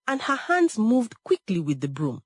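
ordinary delivery, nothing marked

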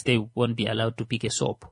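ordinary talk

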